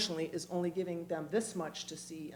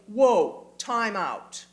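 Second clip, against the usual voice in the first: loud halt; 3 semitones higher, 9 dB louder, much slower than usual. Shown as a demonstration. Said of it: "the moral compunction to say".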